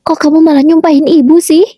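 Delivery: offended mother-in-law, pitched high; angry. A narrator's reading. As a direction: accuse her of cursing you. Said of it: "kata mertuaku marah".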